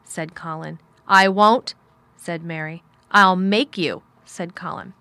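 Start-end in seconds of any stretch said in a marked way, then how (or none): 1.1-1.7 loud, emphatic refusal; stubborn and forceful; defiant refusal. A narrator's reading. 3.1-4.0 loud, forceful threat; heated and domineering; bossy threat. A narrator's reading. Boy threatens in a quarrel.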